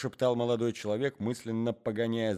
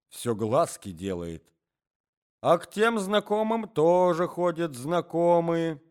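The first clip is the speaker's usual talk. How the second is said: put-on old man's voice; 10 semitones higher, 9 dB louder than usual. A narrator's reading. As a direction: ramble like a doddering grandfather. Old man gossiping.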